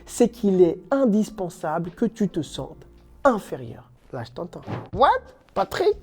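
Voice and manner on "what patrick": surprised. Pretend. Imagined viewer objection.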